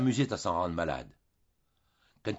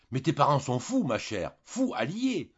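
fort, indigné, plus haut; colère répétée; 5 semitones higher, 3 dB louder than usual. Acted. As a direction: marteler son indignation. Julien répète sa colère à Jeanne. (Julien se plaint à Jeanne, indigné)

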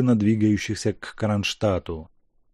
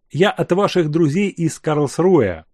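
plain reading voice

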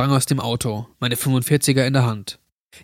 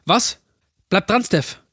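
higher-pitched, urgent call into the phone; alarmed; 7 semitones higher than usual. Acted. Jack urges Stephanie to stay on the line, alarmed. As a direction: urge the caller to hold on. Alarmed reply to panicked caller.

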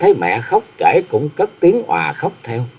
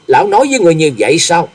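quick, higher shocked question; voice cracking, aghast; 5 semitones higher, 3 dB louder, faster than usual. A narrator's reading. Wife hears what her husband told him, aghast and quick. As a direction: blurt it out, aghast. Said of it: "lạc giọng nói".

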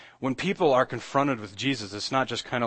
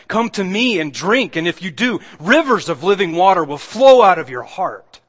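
loud, high-pitched preaching proclamation; astonished proclamation; 6 semitones higher, 10 dB louder than usual. Retold or imitated. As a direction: proclaim the quoted claim in amazement, building louder. Preacher acts out the astonishing claim.

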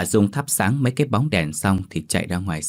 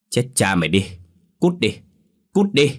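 high, forceful banishing cry; cursing shout; 3 semitones higher than usual. A narrator's reading. Neighbour woman cursing the spirit out, high angry shout. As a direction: drive the spirit out with a curse. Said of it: "Bà Dung chửi lớn".

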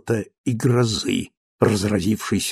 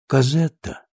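tender recognition. Acted. Old Valjean seeing Cosette return, tender and hushed. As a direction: breathe her name in wonder.

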